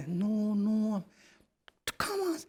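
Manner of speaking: mock coaxing. [name] pulling someone up.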